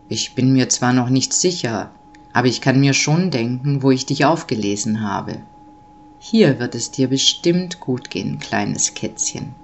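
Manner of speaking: deep, kindly big-man voice; Nikolaus, kindly amused. A narrator's reading. Nikolaus reassures the little kitten, low and amused. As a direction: reassure in a low voice, softening at the end. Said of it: "schmunzelte der Nikolaus".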